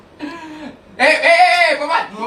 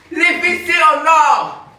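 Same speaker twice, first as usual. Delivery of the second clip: slow announcement; 6 semitones higher, 5 dB louder, slower than usual. Real, not acted. Announces golden toilets again.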